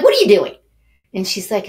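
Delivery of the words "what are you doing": loud, incredulous retold question; re-enacted burst of disbelief; startled challenge. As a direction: blurt it out, acting taken aback.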